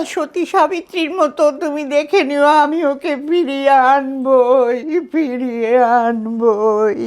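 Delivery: dramatic film-dubbing plea, rising high; acted begging that swells into long-drawn vows; dubbed tearful plea. Shown as a demonstration. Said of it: "ওইটাকে আমাকে মেলাতে হলো".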